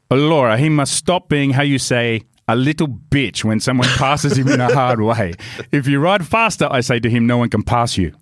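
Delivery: put-on Italian English; Italian accent. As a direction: give advice as a mock-Italian racing mentor. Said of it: "try and do an Italian accent".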